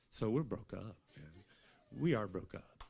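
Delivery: ordinary talk, nothing marked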